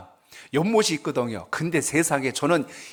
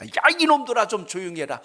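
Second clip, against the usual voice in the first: monk's shout at frogs; 8 semitones higher than usual. Retold or imitated. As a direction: shout at the noisy frogs, fed up. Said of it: "소리를 질렀답니다".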